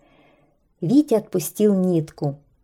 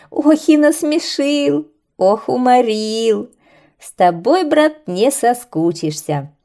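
laughing; 5 semitones higher, 5 dB louder than usual. Acted. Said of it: "смеялись птицы".

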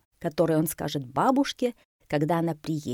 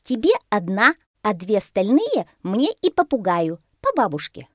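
gleeful monkey, high and loud; delighted; 5 semitones higher, 6 dB louder than usual. A narrator's reading. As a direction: share them out with glee. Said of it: "обрадовалась мартышка". (радостная мартышка, высоко)

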